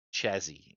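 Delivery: "Chassis" starting with a hard CH; deliberately mispronounced "Chassis", CH not SH. Shown as a demonstration. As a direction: say "Chassis" with CH as in chat. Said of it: "if you wanna be stupid".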